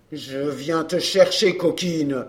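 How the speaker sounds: low, slow threat; growing menace in a low pitch; menacing man. A narrator's reading. Man come to fetch his runaway partner, menacing. Angry man answers her.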